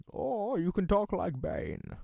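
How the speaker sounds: drawn-out Bane impression; slow theatrical villain voice. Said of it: "I can do Bane".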